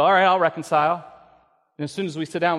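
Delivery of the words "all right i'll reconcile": grudging agreement. Retold or imitated. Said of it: "We go".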